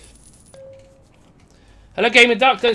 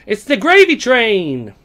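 slow, high, loud proclamation; drawn-out gleeful announcement; excited; 8 semitones higher, 9 dB louder, much slower than usual. Real, not acted. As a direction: stretch it out, thrilled with the haul. Excited over game loot.

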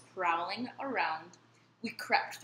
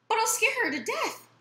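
alarmed; 13 semitones higher, 5 dB louder than usual. A narrator's reading. Child worries about pouncing.

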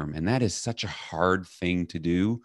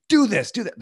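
much higher, louder nagging orders; bossy mother; 6 dB louder than usual. Retold or imitated. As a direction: rattle off commands like a pushy mom.

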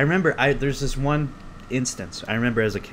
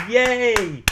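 high, loud drawn-out yay; exaggerated celebratory whoop; mock cheer; 12 semitones higher, 5 dB louder than usual. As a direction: cheer for yourself like a one-person crowd. Cheers his return to podcasting.

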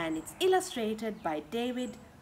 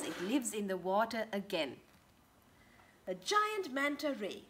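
ordinary delivery

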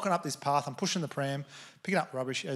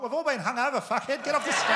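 higher and louder, jeering at him on the landing; heckling a friend telling his Everest story, higher, louder jeer; mock heckling shout; 10 semitones higher, 4 dB louder than usual. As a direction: yell it like a heckler at a bad speech. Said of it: "I was like".

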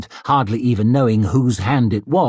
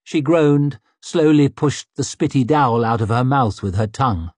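ordinary delivery

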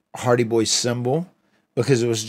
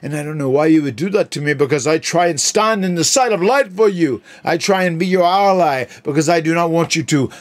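theatrical high pompous voice, then a shout; Broken Matt voice; 4 semitones higher, 3 dB louder than usual. Acted. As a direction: overblown villain, ending in a screamed word. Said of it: "summon the spirit once more of broken Matt".

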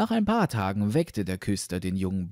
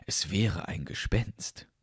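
usual speaking voice

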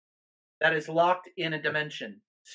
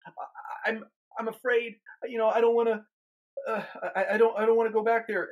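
higher, stammering, acted fear; imitated scared woman; 6 semitones higher than usual. Minister retelling a scared part's plea, imitated fear. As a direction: haltingly mimic someone too afraid to return. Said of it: "she's afraid, she's scared".

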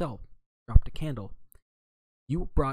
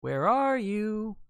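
higher, slow call across the room; 11 semitones higher, 3 dB louder, much slower than usual. A narrator's reading. Narrator calls out looking for the detective, slow. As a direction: stretch it out, searching. Calling through empty room.

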